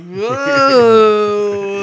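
put-on loud woozy drunk whoa; sway and blurt it, tipsy; Tony Bob. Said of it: "he's doing the Tony Bob".

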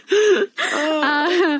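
high squealing laugh; laughing hard. Real, not acted. Host cracking up at the silent-guest joke, squealing laugh. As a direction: laugh until it cracks into a cry.

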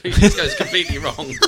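laughing while talking, embarrassed at a failed accent; words tumbling out through laughter. Real, not acted. Laughing at own botched accent while a friend eggs them on.

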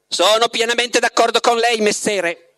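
a cheeky cat chiming in. A narrator's reading. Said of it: "intervenne il gatto".

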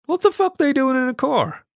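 high-pitched, incredulous outburst; incredulous guard's high-voiced question; baffled guard. A narrator's reading. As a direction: blurt it out high in disbelief. Baffled guard reacts to dogs driving.

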